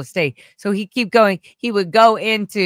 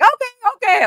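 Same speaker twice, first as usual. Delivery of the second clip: very high, loud mock-obedient squeak; frantic compliance; 6 dB louder than usual. Young assistant answering the nephew's orders, frantic compliance. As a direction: squeal it, scrambling to obey. Imitates herself obeying orders.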